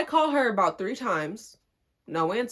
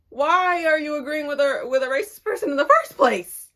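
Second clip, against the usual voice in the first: higher, louder disbelieving demand; exasperated outburst; 6 semitones higher, 5 dB louder than usual. Real, not acted. Questioning why the cousin sided with him, exasperated. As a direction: let the frustration burst out.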